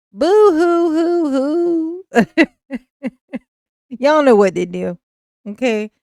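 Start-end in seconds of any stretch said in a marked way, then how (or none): 0.1-2.0 slow hammed-up boo hoo; mock sob, drawn out; fake crying.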